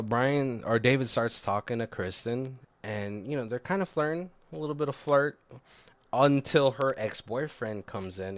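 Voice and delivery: usual speaking voice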